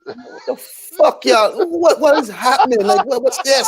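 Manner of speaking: baffled, almost squeaking what the fuck; incredulous disbelief. Real, not acted. Incredulous reaction to fans crying.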